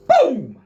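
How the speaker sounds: loud high boom of an erupting volcano; mock explosion. Telling the story of his temper, a loud explosive boom. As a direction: burst it out like lava blowing.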